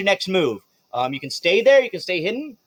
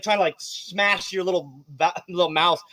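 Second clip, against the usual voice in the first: ordinary talk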